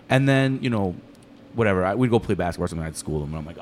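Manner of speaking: usual speaking voice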